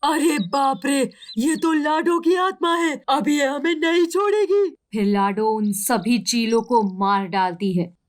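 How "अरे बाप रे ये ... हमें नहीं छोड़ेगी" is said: much higher, terrified villain voice; panicked eagles. Acted. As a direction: recoil in fear of the ghost. Eagles see the ghost. (डरी हुई चीलें, ऊँची घबराई आवाज़ में)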